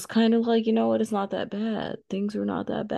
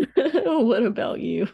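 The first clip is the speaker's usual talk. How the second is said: laughing; 6 semitones higher, 4 dB louder, slower than usual. Real, not acted. Laughing handoff.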